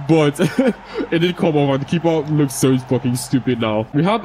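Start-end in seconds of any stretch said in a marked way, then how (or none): 0.4-1.1 a sudden high, breathy laugh at his own cheeky goal; short surprised laugh, pitch jumping up; high burst of laughter. Real, not acted.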